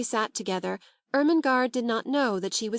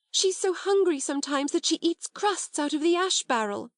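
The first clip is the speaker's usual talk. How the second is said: higher, indignant outburst; burst of outrage; 6 semitones higher than usual. A narrator's reading. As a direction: let the anger burst out. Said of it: "she burst forth".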